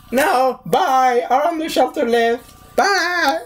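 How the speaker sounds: high put-on brush-off; hammed-up dismissive goodbye; mock callous refusal. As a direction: shut him out in a high, silly voice. Acts out people refusing him.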